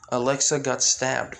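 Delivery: usual speaking voice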